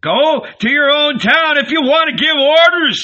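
high, loud screech; high-pitched, strident retort. A narrator's reading. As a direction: screech back in scorn. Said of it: "screeched Simon the Lame".

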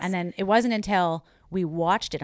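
ordinary talk, nothing marked

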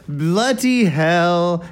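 very slow, drawn-out British "Bloody hell"; put-on British accent. Shown as a demonstration. Host finally crossing the line, hammed-up British accent. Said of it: "All right, I'm gonna do it".

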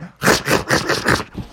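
voiceless rough chomping snarl; imitated vicious dog noise; beast snarl. A narrator's reading. Beast suddenly attacks Froggy, rough snarl.

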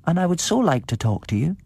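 put-on high little child voice. A narrator's reading. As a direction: speak like an eager small child.

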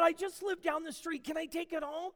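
high, hopeful, excited request; eager pleading. Retold or imitated. Agnes pleads with Harry for the cake, excitedly.

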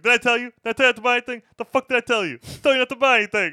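high, loud furious scolding; De Niro yelling. Retold or imitated. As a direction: dress him down like an enraged mob boss. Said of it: "De Niro yelling at you".

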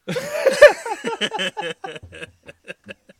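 loud high burst of laughter; cracking up hard at his own joke; big laugh. Real, not acted. Laughing hard at his friend's Power Rangers bit.

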